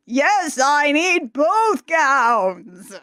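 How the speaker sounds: slow, booming mock genie voice. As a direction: drag it out like a grand genie. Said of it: "doing her, like, Barry Blue Genie voice".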